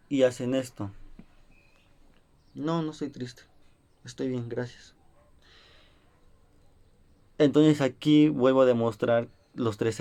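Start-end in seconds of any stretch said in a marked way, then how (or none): 2.5-4.9 sad quiet voice. Shown as a demonstration. Teacher acts a sad child saying he's fine, very soft and downcast. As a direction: say you're fine while sounding dejected and barely audible. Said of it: "un tono de volumen bajo, de tristeza".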